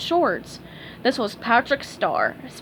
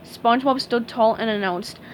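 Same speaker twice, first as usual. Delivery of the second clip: plain reading voice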